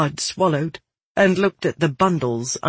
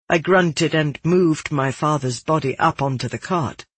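usual speaking voice

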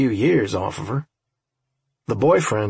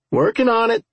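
hurried reply; 10 semitones higher than usual. A narrator's reading. First reply to the girl.

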